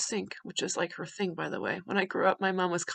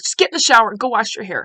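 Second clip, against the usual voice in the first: sharp order; 10 dB louder, faster than usual. Retold or imitated. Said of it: "I was like".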